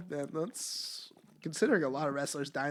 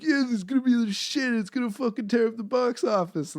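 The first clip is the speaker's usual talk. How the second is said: higher, louder mock swagger; cocky writer; 6 semitones higher, 4 dB louder than usual. Imagining the film's writer, mock cocky boast. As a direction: oversell it like a smug writer.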